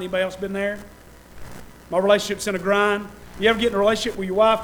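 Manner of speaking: ordinary talk, nothing marked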